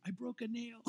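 lightly voiced dainty complaint; mock complaint. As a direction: play up a trivial little grievance. Retold wife's absurdly minor complaint.

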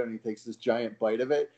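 normal talking voice

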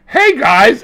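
high, loud "Hey, guys!"; a kid's bright hello to the team; eager greeting. Retold or imitated. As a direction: burst into the room. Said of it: "And he's like".

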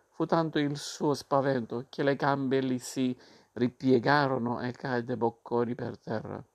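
ordinary delivery

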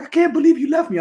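cousin's complaint, pitched far up in mock whine; whiny cousin. Imitating the cousin left behind, a high mock whine. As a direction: do the stranded cousin, high and aggrieved. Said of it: "He's like".

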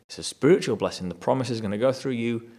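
usual speaking voice

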